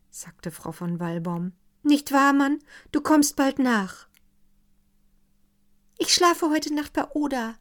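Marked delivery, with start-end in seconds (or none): 1.8-4.1 higher, louder wife; a fretful wife's plea to her husband. Acted. Wife's voice, high. 6.0-7.6 put-on higher girlish voice; a scared young girl; young girl's voice.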